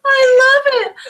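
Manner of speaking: high, loud, laughing through the words; laughing delight. Real, not acted. Host reacting to the bee story, laughing loudly. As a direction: burst out with joy.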